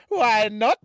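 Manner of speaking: loud, high, squeaky British voice; Galia. Retold or imitated. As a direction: fire it back very high and loud, rising. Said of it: "say that in her voice".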